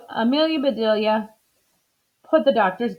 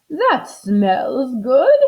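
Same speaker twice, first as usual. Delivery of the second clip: slow, louder admiring line; Mrs Rogers pleased; 4 semitones higher, 6 dB louder, much slower than usual. Acted. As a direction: savour the smell. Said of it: "she said".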